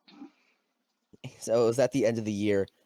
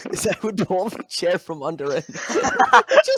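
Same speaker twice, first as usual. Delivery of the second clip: higher, laughing through the words; 9 semitones higher, 7 dB louder than usual. Real, not acted. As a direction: tell it while cracking up. Laughing while recalling his prank.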